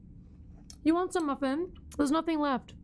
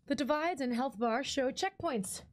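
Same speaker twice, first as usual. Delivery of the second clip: ordinary talk, nothing marked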